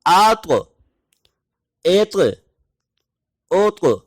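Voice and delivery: آت ایت اوت repeated with an echoing ط; second round of the drill; qalqalah drill. Shown as a demonstration. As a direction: bounce each ط back out. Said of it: "دوبارہ اس کی practice کریں".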